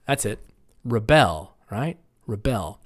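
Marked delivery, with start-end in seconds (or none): 0.8-1.6 reBEL, weak re and strong BEL; "Rebel" as a verb, lower, stressed on BEL; second-syllable stress. Shown as a demonstration. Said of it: "verbs on the second". 2.3-2.8 reBEL, weak re and strong BEL; "Rebel" as a verb, lower, stressed on BEL; second-syllable stress. Shown as a demonstration. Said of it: "verbs on the second".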